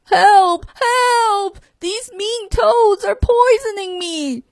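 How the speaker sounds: high, crying cries for help; the monster shrieking in fear; panicked shout. A narrator's reading. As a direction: scream for help in terror.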